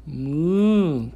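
loud drawn-out moo; mooing cow. Pretend. Parent playing animal sounds with toddler.

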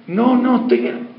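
mock exasperated brush-off; exasperated refusal.

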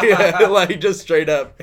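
cracking up while telling it; laughing. Real, not acted. Telling friend the funniest part, laughing out loud.

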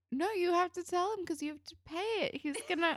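high-pitched mock telling-off; half-amused, high insistent objection; exasperated scolding. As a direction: protest in a high voice as if lecturing her. Acted scolding of a character.

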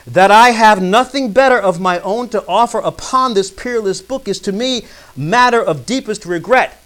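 loud, high, oratorical reading; forceful formal recitation; declaimed quote reading. Acted. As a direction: proclaim the author's words to the room. Reads a quotation aloud.

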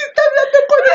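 high, loud giggly squeal; giddy excitement. Retold or imitated. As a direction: squeal over the gossip. Amigas cuchicheando emocionadas. (chillido emocionado y risueño)

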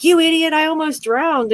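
scolding the friend who laughed, retold angrily; retold angry outburst. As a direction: snap it at her like you're still mad. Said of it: "oh, I was so mad".

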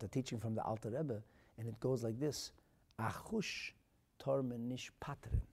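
ordinary delivery

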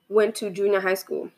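ordinary talk, nothing marked

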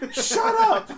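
very high, loud parrot shriek, laughing; squawking parrot. Retold or imitated. Imitating a parrot shouting at a cat, shrieked through laughter. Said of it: "and a parrot was like".

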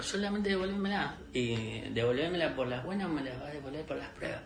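ordinary talk

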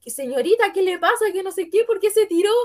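high, rising alarm, lightly voiced; a hint of a startled driver. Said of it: "y dijo".

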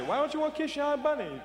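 mother scolding. Retold or imitated. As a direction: pitch it up like a nagging mother. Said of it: "Your mother say".

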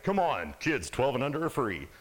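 loud announcer pitch; monster truck ad. Retold or imitated. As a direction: shout the promo like a hype man. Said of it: "monster truck commercials".

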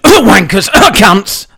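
loud coughed-out swear; coughed outburst. Pretend. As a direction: blurt it out under a cough. Fake Tourette's cough outburst.

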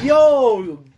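loud, high happy yell; excited shout. Real, not acted. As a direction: call it out with a burst of joy. Greeting a friend's birthday.

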